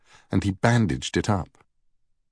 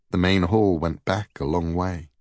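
ordinary delivery, nothing marked

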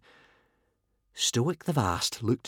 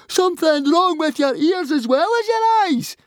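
furious, very high, loud scream; 5 dB louder than usual. A narrator's reading. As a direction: scream the insult at the referee. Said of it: "screamed Fishlegs".

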